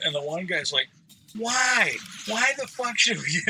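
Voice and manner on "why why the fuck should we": higher-pitched mock indignant protest; going off, retold. One guy objecting to staying longer, imitated outburst. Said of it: "He was just going off".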